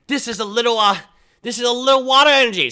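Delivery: put-on high, fast kid pitch; pushy kid. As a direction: rush the offer like a kid. Kid offering junk card.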